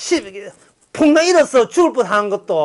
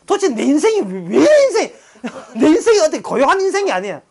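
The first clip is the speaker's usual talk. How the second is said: 높은 소리로 웃으며 하는 한탄; 바울인 척 웃음 섞어 넋두리; 7 semitones higher than usual. Retold or imitated. 바울의 한탄 흉내. (바울의 기막힌 처지를 웃으며 흉내 낸 한탄)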